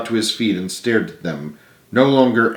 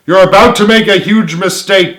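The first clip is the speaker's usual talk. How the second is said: raised urgent plea, higher and louder; crying out; 9 semitones higher, 7 dB louder than usual. Acted. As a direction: call up to him in alarm. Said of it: "cried Clara".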